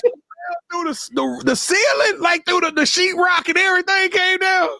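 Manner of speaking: high, incredulous question through laughter; squeaking it out while cracking up; laughing. Real, not acted. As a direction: disbelief breaking into laughter. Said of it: "Stop laughing".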